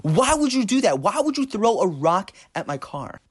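high, loud retold shouting at a kid; angry driver screaming at the boy over a dented car; furious yelling. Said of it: "starts yelling at him at the top of his lungs".

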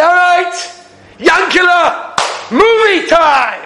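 high, slow, drawn-out call that "movie time" has begun; a big, slow, high showman's call to Yankila; excited announcement. Pretend. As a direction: announce it like a showman opening the curtain. Heavenly announcer calls out.

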